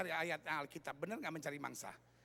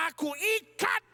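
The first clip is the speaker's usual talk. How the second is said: loud, high commanding shout; acted deliverance-preacher shout; exorcist command; 9 semitones higher, 10 dB louder, slower than usual. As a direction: shout it at the devil, drawn out. Acts out exorcist commanding Satan.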